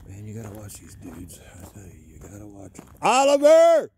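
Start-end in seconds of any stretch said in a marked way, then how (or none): 3.0-3.9 very high, loud shouts; urgent falling calls; calling the dog. Real, not acted. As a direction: holler his name far off. Calling lost dogs outdoors.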